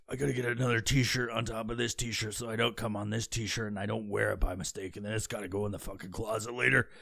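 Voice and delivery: soft, low private muttering; talking to himself. Acted. Man planning t-shirt logistics to himself, soft low muttering. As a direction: think aloud under your breath.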